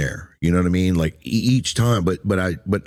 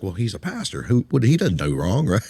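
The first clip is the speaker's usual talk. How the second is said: mock onlooker; 4 semitones higher than usual. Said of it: "one looks at a pastor like".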